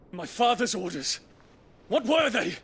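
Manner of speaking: frantic, commanding shout of alarm; urgent shout. Acted. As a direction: demand the orders, rising in panic. Prince demands orders urgently.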